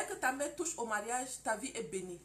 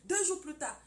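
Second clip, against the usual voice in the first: ordinary delivery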